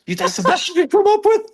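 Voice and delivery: high, loud strained inner voice; mock-anguished squeak at your own lameness; exasperated self-mockery. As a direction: berate yourself in a squeezed, high-pitched voice. Mocking himself in retold inner thought.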